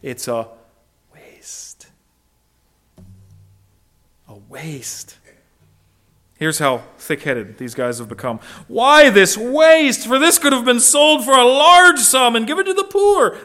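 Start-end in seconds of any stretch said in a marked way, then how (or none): 1.1-1.9 whisper. Acted. Preacher hushing the disciples' scornful word to the congregation, whispered. 4.3-5.2 whisper. Acted. Preacher repeating the disciples' verdict for the congregation, whispered. 8.7-13.4 indignant disciples. Acted. Disciples scolding over the wasted ointment, higher and indignant.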